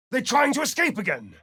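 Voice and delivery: high urgent call; startled alarm; alarmed cry. Acted. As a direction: shout the warning up high. Character alarmed at escape.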